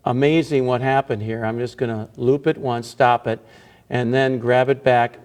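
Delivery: ordinary talk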